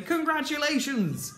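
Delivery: high-pitched prize announcement, hammed up; acted congratulating. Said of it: "Like you might say".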